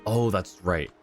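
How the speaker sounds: ordinary talk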